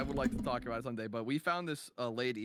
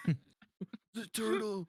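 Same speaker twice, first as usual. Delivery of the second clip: slow, high, spaced-out stoner drawl; 7 semitones higher, much slower than usual. Retold or imitated. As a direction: stretch it out like a stoned guy. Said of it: "that's my stoner voice right there".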